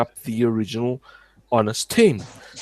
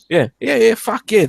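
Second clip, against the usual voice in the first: excited shouting; 12 semitones higher, 5 dB louder than usual. Retold or imitated. Mocking his former angry self.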